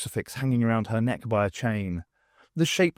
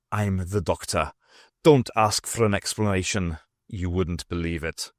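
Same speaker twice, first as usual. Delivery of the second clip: low Scottish voice, matter-of-fact; lower-pitched Scottish stranger; Scots accent; 5 semitones lower than usual. A narrator's reading. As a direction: brush off questions in a Scots accent. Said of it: "he had a distinct Scots accent".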